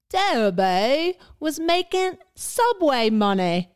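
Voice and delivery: slow, high hammed-up Cockney; exaggerated Cockney comment-reader. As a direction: drag out the vowels in mock Cockney. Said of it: "how do you say Debbie in Cockney".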